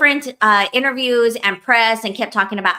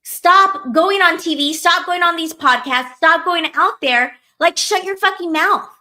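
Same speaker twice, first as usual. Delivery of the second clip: a fed-up rant straight at her, pitched higher; exasperated rant; 6 semitones higher than usual. Real, not acted. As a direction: tell her off, fed up and worked up. Ranting at the singer.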